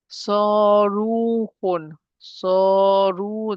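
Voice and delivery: Saruhun very slowly, long held "ruu"; stretched long vowel. Shown as a demonstration. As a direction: draw out both vowels of Saruhun. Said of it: "There's mud here".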